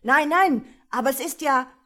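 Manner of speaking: a crowd seeing the devil; alarmed crowd. A narrator's reading. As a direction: shout in sudden terror. Terrified crowd spots Satan.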